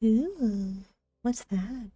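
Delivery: ordinary talk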